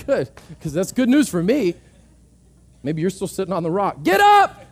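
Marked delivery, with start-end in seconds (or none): 4.0-4.6 much higher, loud exhortation; shouted command. Real, not acted. Preacher calling the congregation to stand, loud shout. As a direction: shout them up off the rock.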